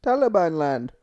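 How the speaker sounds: loud put-on Middle Eastern accent. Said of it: "we're doing an Indian voice".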